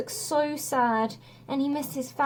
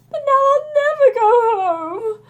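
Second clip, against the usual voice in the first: wailing, much higher and louder; big hero bursting into tears; loud blubbering wail on never; starting to cry; 13 semitones higher, 9 dB louder than usual. A narrator's reading. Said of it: "Hercules started to cry".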